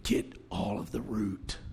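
hushed, slow whisper. Real, not acted. Preacher whispering a lesson to the church. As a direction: lean in and whisper it slowly.

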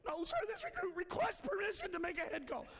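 high, far-off recruit correcting himself; timid high recruit reciting permission. Retold or imitated.

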